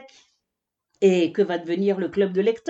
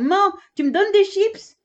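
put-on much higher, louder child's voice; little girl giraffe; 13 semitones higher, 5 dB louder than usual. Little giraffe Kika begs her mother for chips, high and eager. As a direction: bounce in like an eager little kid. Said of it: "une petite girafe déboule".

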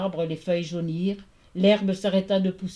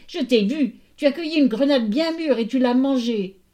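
high, loud accusing ghost; raised rattle-voiced informer; rattling voice; 6 semitones higher, 6 dB louder than usual. A narrator's reading. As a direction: denounce her as a shrill tattling phantom. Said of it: "de sa voix de crécelle".

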